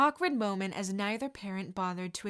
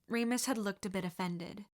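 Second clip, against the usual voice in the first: ordinary delivery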